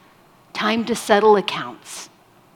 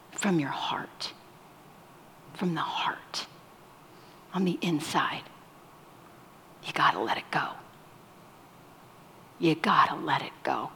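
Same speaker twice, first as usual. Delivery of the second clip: whispered plea; 5 semitones lower, 9 dB quieter than usual. Real, not acted. Pastor drops to intimate plea.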